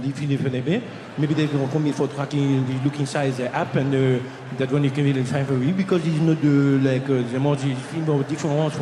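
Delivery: put-on thick, muddled French accent, slightly low; older French gentleman. Older French attendant explaining missing luggage, garbled French accent. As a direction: ramble haltingly in near-unintelligible French-accented English. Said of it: "There's an older French gentleman".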